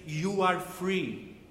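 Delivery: normal talking voice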